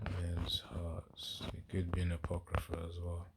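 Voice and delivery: very soft, to oneself while searching; absent self-talk. Real, not acted. Preacher hunting for the men's hearts verse, quiet self-talk. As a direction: think aloud under your breath.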